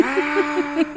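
high, loud yell; loud imitated scream. Man faking possession, screaming loudly. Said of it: "Pero así fuertísimo".

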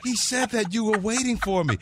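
high, gushing imitation of Tammy; mock woman's voice. Tammy meeting the fake twin, high delighted imitation. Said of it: "She goes".

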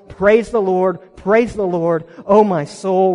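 rising, loud preaching climax; preaching at full force. Real, not acted. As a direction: build to a shout of praise.

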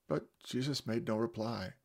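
ordinary delivery

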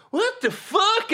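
high, slow, shocked mom voice; be the mom walking in on the mess; mother catches her son, stunned. Retold or imitated. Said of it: "Mom goes".

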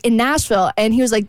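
normal talking voice

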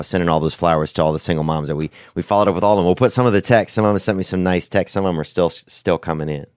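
usual speaking voice

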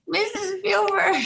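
put-on old woman voice. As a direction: play a sweet old lady meeting her idol. This old woman had got past.

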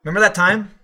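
"Remember" clipped to "member", run into the next word; slurred together. Shown as a demonstration. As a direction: slur "Remember that time" into one word. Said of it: "slur together all the words".

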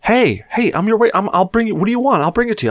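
lightly voiced waitress keen to serve; perky waitress. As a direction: play the eager waitress offering drinks. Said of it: "she would go".

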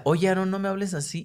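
put-on a big sibling putting the kid in his place; scolding brother. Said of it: "Yo le decía".

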